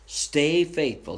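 slow it right down, each word weighed; slow earnest repeat. Acted. Preacher repeating his plea to the church, slow and deliberate. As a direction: let the repeat sink in slowly.

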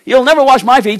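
loud stubborn refusal. Acted. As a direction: declare it like a stubborn disciple. Peter refuses again.

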